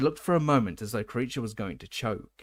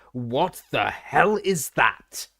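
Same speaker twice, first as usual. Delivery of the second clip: slow, loud, angry bellow; 3 semitones higher, 7 dB louder, much slower than usual. A narrator's reading. Uncle Vernon bellowing at the filthy house elf, slow and loud. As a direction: roar it out in shock and disgust. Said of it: "Uncle Vernon bellowed".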